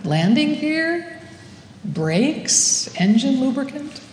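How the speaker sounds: halting questions with steep upward lifts; mock-worried guesses, each left hanging as a question; uneasy guessing. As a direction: run through possibilities, questioning each one. Anxious guessing at what fluid does.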